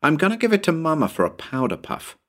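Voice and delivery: put-on high-pitched child claiming a prize; eager little boy. Tommy claims the rabbit's tail, high eager boy voice. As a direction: pipe up high like a keen small boy. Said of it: "Tommy put in his claim".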